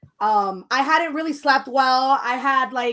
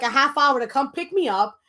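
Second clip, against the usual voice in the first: ordinary talk, nothing marked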